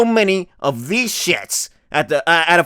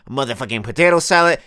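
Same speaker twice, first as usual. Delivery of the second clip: ordinary talk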